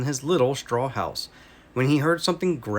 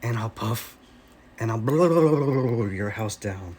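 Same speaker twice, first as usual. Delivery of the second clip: menacing wolf; 3 semitones lower than usual. Acted. Wolf threatens.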